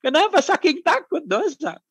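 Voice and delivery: laughing. Real, not acted.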